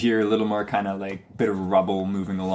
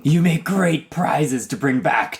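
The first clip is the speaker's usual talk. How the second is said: higher, strained monster voice; loud gloating fiend calling from the air; spiny devil; 6 semitones higher, 4 dB louder than usual. Acted. Flying devil taunting the humanoids it fought, monster voice.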